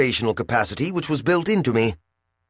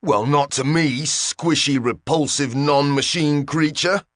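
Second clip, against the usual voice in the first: higher, slow and indignant machine; heated; 6 semitones higher than usual. A narrator's reading. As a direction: hurl the insults in a huff. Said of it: "the translator said heatedly".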